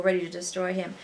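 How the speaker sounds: ordinary delivery, nothing marked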